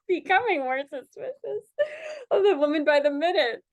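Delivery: rereading through laughter; laughing while reading. Real, not acted.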